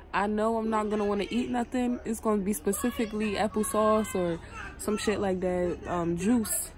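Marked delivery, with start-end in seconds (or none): none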